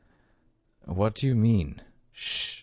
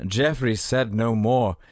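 ordinary delivery, nothing marked

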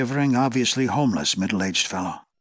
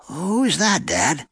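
calling out; 5 semitones higher, much slower than usual. A narrator's reading. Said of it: "the boy called".

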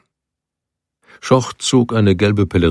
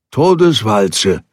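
slow, drawn-out, louder call; warning called from the bank; 3 semitones higher, 4 dB louder, slower than usual. A narrator's reading. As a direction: stretch the word out ominously. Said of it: "rief er".

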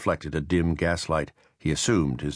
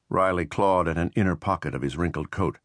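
plain reading voice

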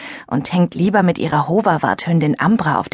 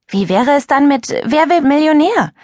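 put-on higher, louder boy's suggestion; a lively boy voice offering a game; eager question; 9 semitones higher, 4 dB louder than usual. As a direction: pitch it up as a hopeful idea. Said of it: "fragte Jannis".

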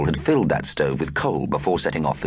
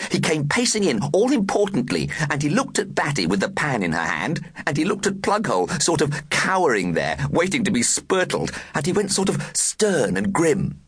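put-on excited girl voice; 10 semitones higher than usual. A narrator's reading. Girl retells the scene.

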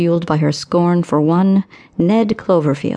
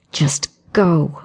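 command. A narrator's reading. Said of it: "she commanded".

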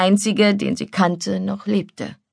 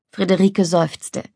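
ordinary delivery

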